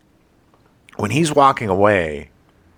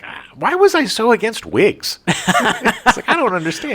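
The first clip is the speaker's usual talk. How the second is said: imitating Elijah; 10 semitones higher than usual. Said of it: "Elijah was like".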